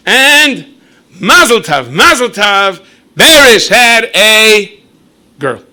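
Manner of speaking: high, loud, slow drawn-out announcement. Acted. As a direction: build it up loudly, then drop the punchline. Announcing the birth dramatically.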